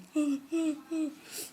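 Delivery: sharp loud gasp of alarm; shocked gasp. Retold or imitated. Gasping at the website warning, shocked. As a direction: gasp as if caught out. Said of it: "I was like".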